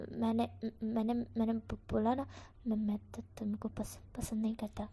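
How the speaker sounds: quiet, halting stammer with repeated words. A narrator's reading. Wang Ji blushing, denies liking Wing in a soft stammer. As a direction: mumble the denial, tripping on every word. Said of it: "फिर से हकला के बोलता है".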